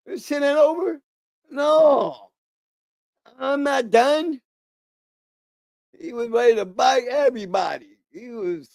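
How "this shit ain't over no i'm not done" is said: a mild hint of a raging man, slow at the end; lightly voiced defiant yell; half-hearted mock screaming defiance. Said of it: "he's screaming in the corner".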